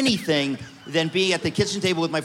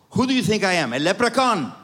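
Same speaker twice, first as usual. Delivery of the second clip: put-on angry Greek dad, mildly accented; father's Greek accent; 3 semitones higher than usual. Angry father snapping at his eighteen-year-old, mild Greek accent. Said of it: "in my father's accent".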